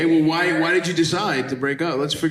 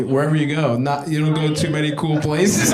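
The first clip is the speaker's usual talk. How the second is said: normal talking voice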